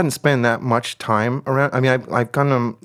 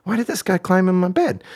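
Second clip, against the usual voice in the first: grumpy grumbling; 6 semitones higher than usual. Retold or imitated. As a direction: retell a sleepy man's mild grievance. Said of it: "kinda grumpy and kinda like".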